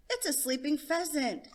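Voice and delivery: put-on a boy pointing out his find; child voice.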